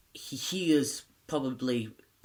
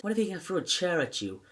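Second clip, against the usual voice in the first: quick, higher-pitched mock challenge; lightly acted confrontational teacher; confronting teacher; 7 semitones higher, faster than usual. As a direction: fire the question at him fast. Acted teacher confronting startled pupil.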